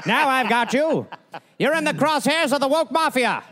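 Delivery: high, laughing villain gloat; silent film villain. Retold or imitated. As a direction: ham it up like a cartoon baddie. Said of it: "like silent film villain".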